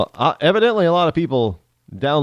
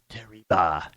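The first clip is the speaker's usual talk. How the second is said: low, softer Spanish "Terriba"; Spanish accent; 7 semitones lower, 5 dB quieter than usual. Shown as a demonstration. Host trying the surname as Spanish, low Spanish accent. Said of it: "could also be Spanish".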